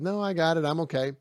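put-on proud young man waving off help; proud refusal. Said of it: "And he says".

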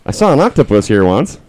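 high little creature voice; crab voice. Acted. Crab telling druid what it saw, high crab voice. Game master answers as a crab.

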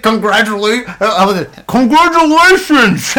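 hammed-up doctor shouting congratulations through a laugh; over-the-top cheerful surgeon; mock doctor. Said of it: "He's like".

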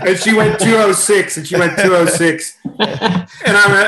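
high, loud, laughing through the words. Real, not acted. Storyteller giving the swim time to his listeners, laughing. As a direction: tell it while cracking up. Still laughing after punchline.

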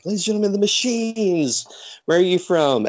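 high, announcer-style intro; imitating a ring announcer; lightly hammed announcer.

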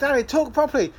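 put-on a touch of a child complaining; imitating his son. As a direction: play the little son telling dad off. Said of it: "it's like".

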